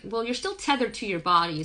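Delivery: normal talking voice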